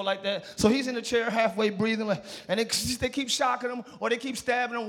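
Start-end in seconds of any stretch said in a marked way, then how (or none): none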